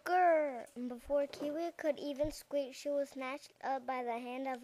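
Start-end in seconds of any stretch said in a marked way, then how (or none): 0.0-0.7 short growl from the lion, louder and higher; a reader's hearty lion growl. Acted. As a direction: growl like a lion grabbing its prey. Lion about to eat mouse.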